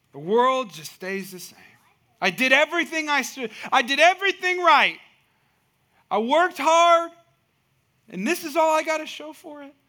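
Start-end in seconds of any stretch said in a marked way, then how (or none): 2.2-9.6 frustrated outcry. Pretend. Worker complaining to the congregation, loud frustrated outcry. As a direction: cry out like all the hard work was for nothing.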